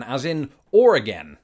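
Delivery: "Oregon" as "or" plus "again", stressing the ending; Oregon rhyming with again. Shown as a demonstration. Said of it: "merging the two words or and again".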